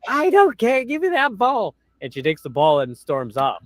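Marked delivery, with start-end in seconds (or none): 0.1-1.8 mock Karen retort. Karen snapping back at the fan, high and bratty. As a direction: sneer it like an entitled woman. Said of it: "Karen's retort in retold quarrel".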